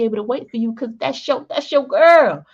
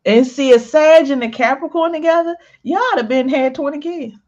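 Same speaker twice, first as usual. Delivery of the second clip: excited; 6 semitones higher, 5 dB louder than usual. Real, not acted. Excited reaction to Sag and Capricorn.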